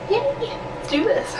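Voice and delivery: normal talking voice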